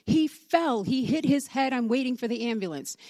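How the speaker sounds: imitating a frantic woman shouting for help; retold screaming. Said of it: "she is screaming".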